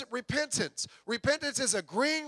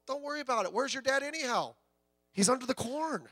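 put-on softer, gentle old farmer inviting the boy in; kindly old farmer; 3 semitones higher, 5 dB quieter than usual. Preacher voicing the old farmer calming the boy, softly. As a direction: soothe him like a kindly old neighbor.

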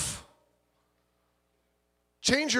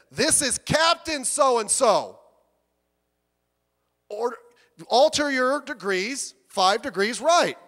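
slow, louder commanding captain; angry captain; 4 semitones higher, 6 dB louder, slower than usual. Acted. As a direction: bark the order like an offended captain. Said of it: "The captain got mad and said".

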